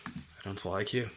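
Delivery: put-on Irish accent. Repeating the Irish robber's threat, low and slow, in an Irish accent. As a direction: breathe the threat in an Irish lilt. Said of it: "pretty good Irish accent".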